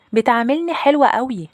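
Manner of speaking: put-on high-pitched little girl voice; little sister. Little sister tells her older sister she loves the maid, high little-girl voice. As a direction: play an eager little sister, pitched well up. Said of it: "أختي الصغيرة ... ردت عليا وقالت لي".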